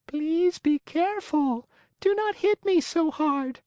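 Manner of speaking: tiny wooden voice pleading not to be hit; beseeching wee voice. Acted. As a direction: beg him to stop in a tiny high voice. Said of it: "a wee little voice say in a beseeching tone".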